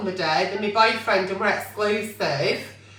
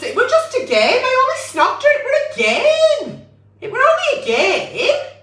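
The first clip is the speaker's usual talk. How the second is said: mocking the boyfriend; 13 semitones higher than usual. Advising the girl, mimicking her boyfriend's excuse in a silly high whine. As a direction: take the mick out of his lame excuse in a silly voice.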